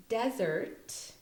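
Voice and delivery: DESERT as "DEZ-ert", set apart; careful DESERT with Z, front-stressed. Shown as a demonstration. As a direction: hit the first syllable of DESERT. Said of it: "emphasis on the de part".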